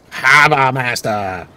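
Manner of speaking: "Harbor Master" slow, with both r's dropped as hahba mastah, a mocking Boston accent; overdone Boston accent. Gamer mocks the voice actor's Harbor Master in a hammed-up Boston accent. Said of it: "really lost it on".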